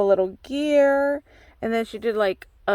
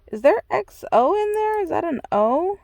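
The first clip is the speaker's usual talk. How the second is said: surprised high question; 8 semitones higher than usual. Real, not acted. Spotting letters in sequins.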